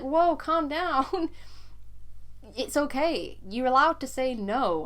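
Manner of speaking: gently calm her down, lightly played; calm reassurance. Partner soothing his panicked girlfriend, gently reassuring.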